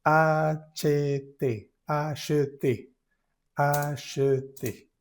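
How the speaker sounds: letters spelled out slowly; slow letter-by-letter spelling. Shown as a demonstration. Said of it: "tratar de separarla por sílabas".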